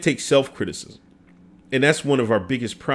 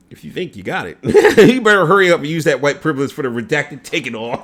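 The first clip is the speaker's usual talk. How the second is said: laughing; 4 semitones higher, 7 dB louder than usual. Real, not acted. Jokes while laughing.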